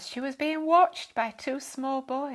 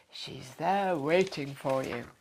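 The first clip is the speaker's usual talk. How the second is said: reassuring; 7 semitones lower, slower than usual. A narrator's reading. Dad reassures the lady.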